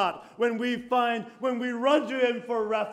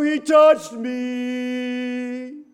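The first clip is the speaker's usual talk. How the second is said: ecstatic cry; 5 semitones higher, 8 dB louder, much slower than usual. Real, not acted. Ecstatic outburst of testimony.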